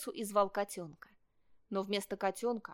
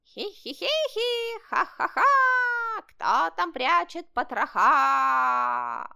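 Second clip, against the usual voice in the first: high, drawn-out spooky cackle, then a loud wail; shouting mock ghosts; 7 semitones higher, 9 dB louder, much slower than usual. A narrator's reading. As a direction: cackle and howl like a pretend ghost. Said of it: "прыгали вокруг него и кричали". (пугающий хохот и протяжный вой привидений)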